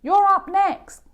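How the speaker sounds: high, loud call across the playground. A narrator's reading. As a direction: shout it over to him from far off. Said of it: "Emilio calls out from the tetherball court".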